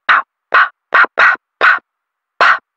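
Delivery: short, crisp, separated pops; popping mouth noises. Shown as a demonstration. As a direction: pop it out in little percussive bursts.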